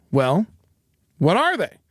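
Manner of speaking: ordinary talk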